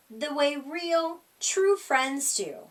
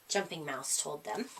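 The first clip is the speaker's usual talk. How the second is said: ordinary delivery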